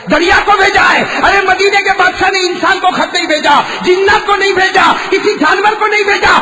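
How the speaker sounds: high, loud, impassioned sermon cry; fiery preacher at full pitch; preaching at full force. Acted. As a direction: thunder the miracle out to the crowd. Preacher building to climax.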